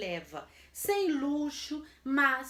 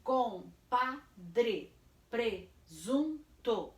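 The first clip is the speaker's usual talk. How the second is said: "compadre, presunto" slow, in separate syllables; much slower than usual. Shown as a demonstration. Teacher reviews the list, "compadre, presunto" syllable by syllable. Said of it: "eu vou separar".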